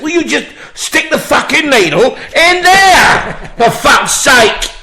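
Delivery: loud, high, slow furious demand; exasperated shout. Retold or imitated. Patient shouting at the nurse to just stick the needle in, furious. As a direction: lose your temper at the nurse. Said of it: "I said".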